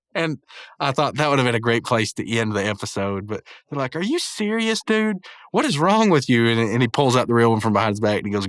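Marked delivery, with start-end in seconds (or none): 4.0-6.5 high-pitched indignant disbelief, acted; mock outrage. Friends confront the prankster over the fake, imitated outrage. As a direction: demand an answer, pitch way up.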